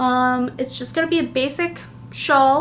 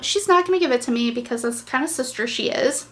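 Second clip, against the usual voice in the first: ordinary delivery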